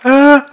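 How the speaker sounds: short high, loud yelp; mock cry of fear. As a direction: cry out in comic fright. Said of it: "they cried out for fear".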